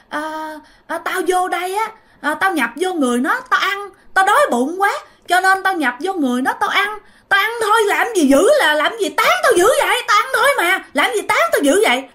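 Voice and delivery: high pleading ghost complaining of being beaten; strange ghost voice. Retold or imitated. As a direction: whine like a hungry ghost inside her. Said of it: "cái giọng của cô ba cũng không phải là cô ba".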